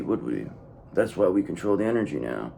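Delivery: ordinary talk, nothing marked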